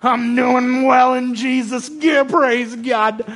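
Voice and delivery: high, loud, drawn-out preacher shout; hammed-up revival preacher, rising and slow; mock preacher's voice. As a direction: put on a big preacher's holler, stretching the words. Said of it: "wanna have a good preacher's voice".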